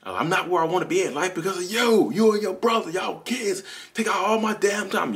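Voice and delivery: high-pitched, strained retold yell; acting out an angry, high-voiced rant; yelling and screaming. Dad at his son over not succeeding, mock screaming. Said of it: "I was yelling and screaming".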